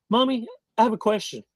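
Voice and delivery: put-on high, quick kid voice; eager child voice, pitched high; little boy asking Mommy. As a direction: pipe up fast and high like a child. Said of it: "looks at his mommy and says".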